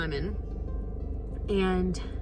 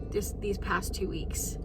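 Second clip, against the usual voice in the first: whisper; 5 dB quieter than usual. Real, not acted. Overwhelmed, dropping to a whisper.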